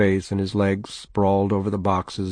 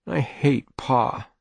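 put-on slow, higher-pitched child's resentment; understated sullen declaration, drawn out; glowering; 4 semitones higher, slower than usual. A narrator's reading. Boy to his mother about his father, glowering. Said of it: "glowered under the brim".

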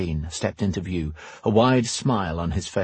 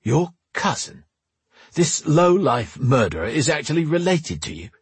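high-pitched, disbelieving indignation; incredulous outrage; 7 semitones higher than usual. Acted. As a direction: throw it back at her in outraged disbelief. Drake outraged at the revelation.

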